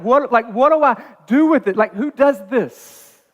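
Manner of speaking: high, loud, laughing disbelief; can't believe it, half laughing; stunned delight. Retold or imitated. Pastor telling the church how he reacted to the gift, laughing in amazement.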